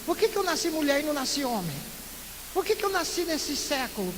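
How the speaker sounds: ordinary delivery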